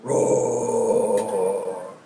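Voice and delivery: lightly voiced giant roaring; a mock giant bellow, drawn out. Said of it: "It goes".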